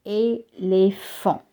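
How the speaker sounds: "Éléphant" said slowly, each é as a clear ay sound; French pronunciation. Shown as a demonstration. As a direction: stretch out "Éléphant" for learners. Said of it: "in clé and éléphant ... read it as A".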